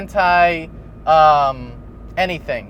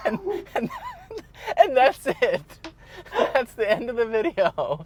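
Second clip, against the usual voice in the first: laughing; 7 semitones higher, 3 dB quieter than usual. Real, not acted. Cracking up retelling the video.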